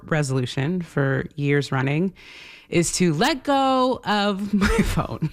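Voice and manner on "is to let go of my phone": words breaking into a real laugh; laughing confession. Real, not acted. Host confessing her phone resolution to listeners, laughing. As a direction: laugh at yourself as you admit it.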